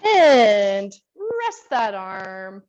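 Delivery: very slow, stretched-out instruction; drawn-out exercise cue. Real, not acted. Instructor ends the class's arm stretch, slow drawn-out cue. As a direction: stretch each word as the muscle releases.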